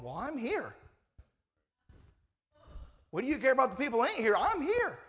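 whiny offended protest; indignant complaint. Acted. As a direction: play the overlooked one, pitch climbing. Acted complaint of those who showed up.

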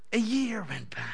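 hushed whisper for effect; storyteller's hush; whispered aside. Acted. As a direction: drop to a whisper as time passes. Dramatic pause in the story.

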